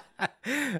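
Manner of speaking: high evil laugh; evil villain laugh. Acted. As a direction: cackle like a cartoon villain. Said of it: "That was a really good evil villain laugh you gave".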